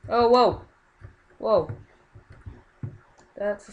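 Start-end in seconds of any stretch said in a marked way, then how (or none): 0.1-1.7 loud, high jolt of surprise; startled surprise. Real, not acted. Player caught off guard in a match, startled surprise. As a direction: react out loud, pitch jumping up.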